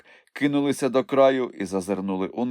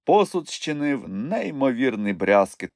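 plain reading voice

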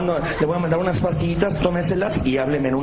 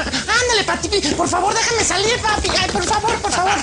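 put-on very high pleading girl; mock daughter's insistent begging; 13 semitones higher than usual. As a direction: wheedle dad in a squeaky high voice. Daughter begging dad for permission.

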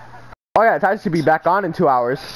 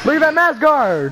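exasperated; 6 semitones higher than usual. Real, not acted. Complaining about opponent's move.

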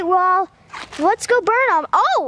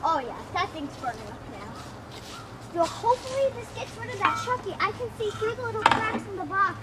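hushed narration; 13 dB quieter than usual. Real, not acted. Vlogger narrating quietly while burning the box, softer. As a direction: talk softly over the fire.

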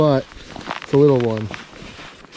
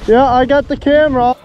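excited; 9 semitones higher, 7 dB louder than usual. Real, not acted. Excited during a bite.

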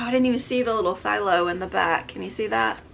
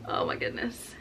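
whisper; 2 semitones higher, 8 dB quieter than usual. Real, not acted. Awed by the ornament.

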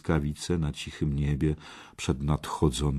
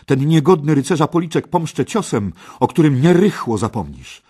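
high, forceful threat of revenge; contemptuous vow; 9 semitones higher, 11 dB louder than usual. Acted. Parsival vows to avenge the slap, loud and scornful. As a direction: swear vengeance loudly and defiantly. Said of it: "spoglądając z pogardą na Keja".

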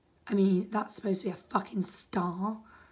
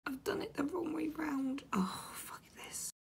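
breathy whisper of defeat, much softer; frustrated whisper; 4 semitones higher, 9 dB quieter than usual. Real, not acted. Builder realises a mistake, frustrated whisper. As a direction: whisper it as you give up.

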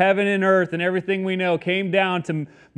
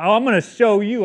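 higher, louder mock comeback; cocky threat; 5 semitones higher, 6 dB louder than usual. Preacher imagining himself answering mockers from the cross, mock defiant. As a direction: play-act a showy threat to get even. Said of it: "like a scary ghost on Halloween".

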